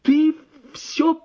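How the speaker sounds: high, loud and slow, half-crazed; горестный дикий голос; wild voice. A narrator's reading. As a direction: gasp it out in disbelief. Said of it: "спросил я диким голосом".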